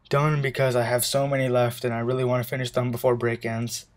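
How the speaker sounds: ordinary delivery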